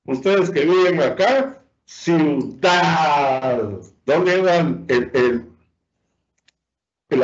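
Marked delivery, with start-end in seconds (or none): 1.8-3.7 ciudad with a long, weighty "dad"; stress on final syllable. Shown as a demonstration. Teacher giving an example to locals, ciudad stressed on "dad". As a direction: stretch the last syllable of ciudad. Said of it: "se pronuncia con mayor intensidad".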